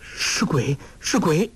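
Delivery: frightened gasp, rising high; a girl's scared whisper-cry; trembling voice. A narrator's reading. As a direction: clutch his arm and stammer in terror. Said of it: "颤声说".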